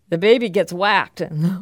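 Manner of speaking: ordinary talk, nothing marked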